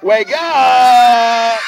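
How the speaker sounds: big, loud, long-held holler; shouted wake-up call. Acted. Waking the animal who dozed off, shouting. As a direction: shout to jolt a sleeper awake. Rousing a sleeper with the audience.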